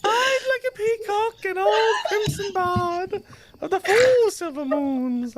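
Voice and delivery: loud, high, laughing mock lament; hammed-up wailing recital. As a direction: belt the poem like a tragic wail. Overdramatic mock poem reading.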